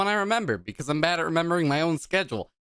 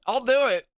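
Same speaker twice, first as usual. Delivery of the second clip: usual speaking voice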